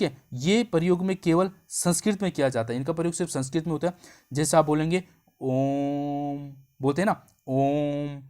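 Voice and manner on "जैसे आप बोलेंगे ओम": ओम drawn out very long and slow; prolonged Om. Shown as a demonstration. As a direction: chant ओम with a long held vowel. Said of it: "ओम में आपको बहुत ज़्यादा समय लग रहा है".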